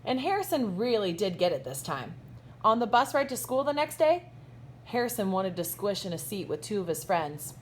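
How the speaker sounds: lively, bright storybook read-aloud with big swoops; reading to children. A narrator's reading. Teacher reading a picture book to her kindergartners, animated storybook voice. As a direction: read it like story time for little kids.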